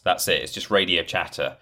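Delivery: normal talking voice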